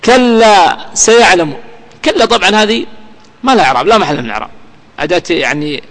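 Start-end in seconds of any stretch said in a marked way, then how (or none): 0.1-1.5 very slow, drawn-out chanted verse; Quran recitation. Acted. Teacher recites the verse before parsing it, slow chant. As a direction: stretch every syllable as in tajweed.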